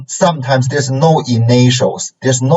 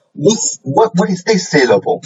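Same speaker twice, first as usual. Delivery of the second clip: questioning student; 7 semitones higher than usual. Acted. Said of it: "maybe some students will ask".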